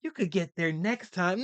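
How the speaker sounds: put-on high, soft, babying voice like a nursery teacher; soft coddling voice. Mocking how therapists talk to men, in a high, soft coo. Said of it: "psychology ... spoken to like".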